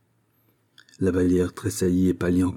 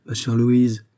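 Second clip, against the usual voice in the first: ordinary delivery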